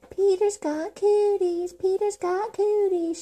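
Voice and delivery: high, slow playground chant; yelled taunt. A narrator's reading. Girl chasing boy on playground, high yelled taunt. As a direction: yell it as a high, drawn-out teasing chant. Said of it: "she yelled".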